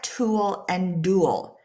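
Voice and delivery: slow. Shown as a demonstration. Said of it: "Look at".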